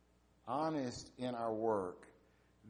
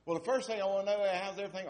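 higher, quicker voice for the traveling wife; the wife's voice; 7 semitones higher, 4 dB louder, faster than usual. Retold or imitated. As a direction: voice the eager wife calling home, pitched up. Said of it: "And she said".

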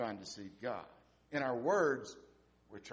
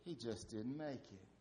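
softer, gentle breaking of bad news; very gently; 10 dB quieter than usual. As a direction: ease the bad news out softly. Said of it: "very gently said".

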